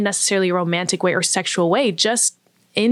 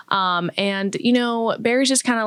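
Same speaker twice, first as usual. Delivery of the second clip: normal talking voice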